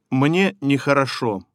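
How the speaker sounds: normal talking voice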